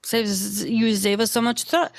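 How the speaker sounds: normal talking voice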